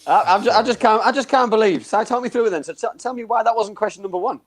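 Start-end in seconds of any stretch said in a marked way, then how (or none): none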